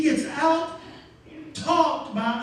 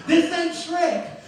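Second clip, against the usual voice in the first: slow punchline; 4 semitones higher, much slower than usual. Real, not acted. Preacher's punchline aside.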